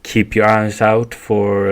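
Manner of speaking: ordinary delivery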